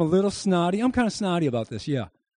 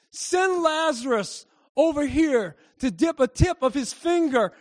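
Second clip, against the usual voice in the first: pleading rich man; 7 semitones higher than usual. A narrator's reading. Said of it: "And the rich man shouted".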